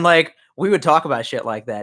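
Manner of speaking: ordinary delivery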